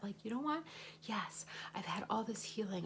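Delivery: ordinary delivery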